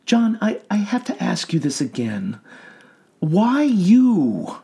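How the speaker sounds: soothing clinical questioning; calm doctor voice. A narrator's reading. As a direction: gently probe a patient.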